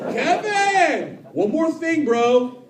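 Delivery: put-on high drunk-fan holler; mock shouting across a store; imitated yell. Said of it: "Why are people yelling".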